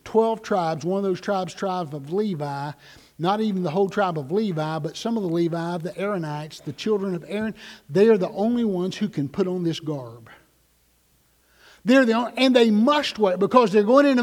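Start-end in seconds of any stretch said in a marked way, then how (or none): none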